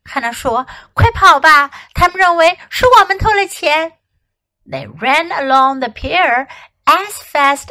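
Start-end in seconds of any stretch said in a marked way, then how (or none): 0.9-4.0 urgent. Acted. Children fleeing in alarm.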